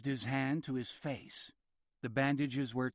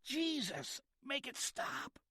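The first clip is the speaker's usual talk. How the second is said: very high, strained plea; patient begging, high and strained with pain; pained cry; 13 semitones higher, 5 dB quieter, slower than usual. A narrator's reading. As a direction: beg for the pain to end. Excruciating pain, pleading.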